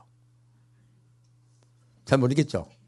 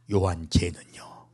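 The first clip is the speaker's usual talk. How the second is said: slow unvoiced whisper; sly whispered aside; 6 semitones lower, 6 dB quieter, slower than usual. Peter whispering to Jesus about John, slow whisper. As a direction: lean in and whisper it jealously.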